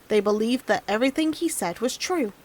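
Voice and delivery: ordinary delivery, nothing marked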